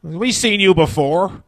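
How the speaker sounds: slow, high, drawn-out knowing tease; Belfast punter. Retold or imitated. Front-row fans from Belfast teasing the comic, slow and sly. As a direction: stretch it out slyly. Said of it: "from Belfast".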